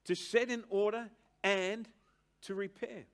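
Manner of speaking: preaching at full force. Acted. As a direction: drive the definition home from the pulpit. Preacher repeating the definition.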